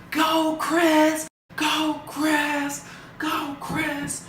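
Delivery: high-pitched mock crowd cheer, held back in volume; imitated cheering boyfriend. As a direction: chant it like a far-away supporter.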